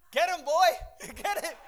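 high, loud yell setting the dog on someone; shouted dog command. Retold or imitated. Owner to dog as the intruder flees, shouted command. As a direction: imitate an owner sending the dog after a runner. People setting dogs on him.

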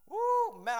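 high, loud woo; teasing party cry; mock party whoop. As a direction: let out a party holler. Temptation of the party acted.

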